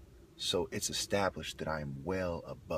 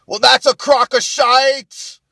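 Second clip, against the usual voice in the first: put-on high, drawn-out Irish-accented shout; Irish heckler; 21 dB louder, slower than usual. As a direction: dismiss it with a loud mock Irish yell. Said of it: "Anything you have to say automatically is".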